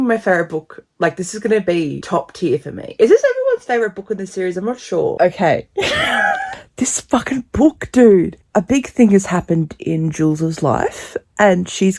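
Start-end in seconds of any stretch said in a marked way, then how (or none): 5.7-6.5 very high, tearful-sounding giggle; high squealing laugh. Real, not acted. Reader overcome by her favorite book, high squealing laugh.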